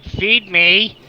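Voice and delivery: loud, high mock demand; imitating hungry chickens. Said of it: "they're looking at me right now like".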